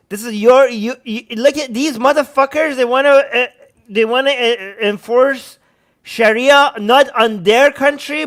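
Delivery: normal talking voice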